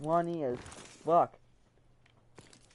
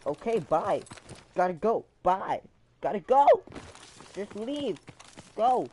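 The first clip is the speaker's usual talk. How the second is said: high, loud, insistent imitated goodbye in short bursts; pushy dismissal; 7 semitones higher, 7 dB louder than usual. Little cousin pushing the speaker out the door, loud insistent dismissal. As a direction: shoo someone out, rising in pitch. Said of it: "he tried to push me out the door".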